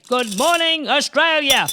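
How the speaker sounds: loud, high morning holler. Acted. Greeting all Australia on getting out of bed, very loudly. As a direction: call it out to a whole country. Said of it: "I say very loudly".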